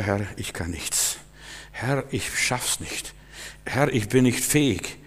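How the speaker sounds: very soft, slow, meek confession; hushed mock self-abasement; false humility. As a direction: plead worthlessness in a small voice. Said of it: "das ist falsche Demut".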